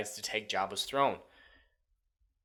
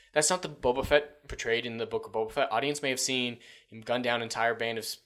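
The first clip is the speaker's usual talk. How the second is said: ordinary delivery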